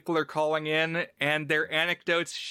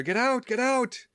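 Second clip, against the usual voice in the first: higher-pitched imitation of panicked yelling; mock yell; 8 semitones higher than usual. Presenters yelling at each other as chaos breaks out, mock yell. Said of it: "presenters are yelling at each other".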